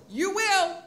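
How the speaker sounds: high, loud, insistent retort; calling after someone. Retold or imitated. Teacher to student behind slammed door, insistent call. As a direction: holler it after the departing kid.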